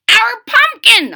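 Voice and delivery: put-on very high, loud old-man holler. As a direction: bellow it out. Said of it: "hollered the old man".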